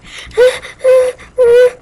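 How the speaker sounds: very high, loud bursts; three shrieky yelps of fright; scared squeals. Pretend. Scared by screaming in game.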